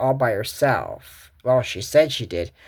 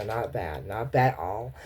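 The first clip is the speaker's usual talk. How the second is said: quiet approval; 5 semitones lower, 8 dB quieter than usual. Acted.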